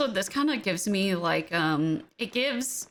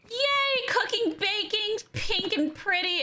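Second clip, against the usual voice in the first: put-on much higher, sugary girly voice; cutesy high voice; 12 semitones higher, slower than usual. Streamer voices her pink baking area in a much higher cutesy tone. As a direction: squeak it out like a pink cottagecore fan.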